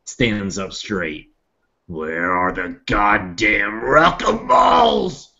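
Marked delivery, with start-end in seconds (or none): 1.9-5.3 in-character battle roar, stretched out; roared demand. Acted. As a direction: draw it out, then bellow the last word. Nog flexes and roars his demand.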